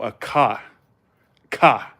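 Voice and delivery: "car" with no R; New England "car", R dropped; Northern pronunciation. Retold or imitated. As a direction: say "car" as an open "cah". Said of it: "they call a vehicle".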